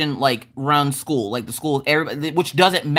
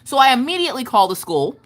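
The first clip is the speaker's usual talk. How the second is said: ordinary talk, nothing marked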